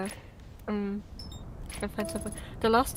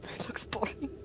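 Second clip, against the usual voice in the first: whisper; 10 dB quieter than usual. Real, not acted. Whispered aside about golf.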